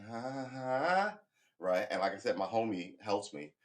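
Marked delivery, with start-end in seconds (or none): 0.1-1.2 mock laugh. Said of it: "Was like".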